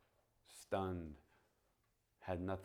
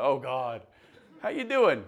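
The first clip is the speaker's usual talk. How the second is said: "how you doing" said laughing, much higher and louder; laughing retelling; 7 semitones higher, 17 dB louder than usual. Retold or imitated. Preacher retelling his awkward greeting to Gail, laughing through it. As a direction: laugh through the clumsy line as you repeat it. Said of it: "that's how I feel right now".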